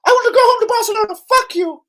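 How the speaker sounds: hammed-up whining footballer; whiny homesick player. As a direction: sneer it like a spoiled player crying for home. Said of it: "and says".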